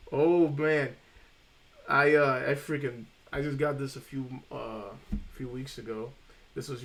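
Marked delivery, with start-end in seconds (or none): none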